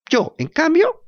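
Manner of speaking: stubborn puppet. Acted. Pinocho answers the cricket.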